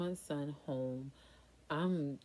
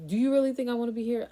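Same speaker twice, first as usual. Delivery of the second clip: higher, louder and fast, fed up; exasperated outburst; 9 semitones higher, 10 dB louder, faster than usual. Retold or imitated. Patient snapping back at the doctor, exasperated and loud. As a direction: fire it back at him in disbelief.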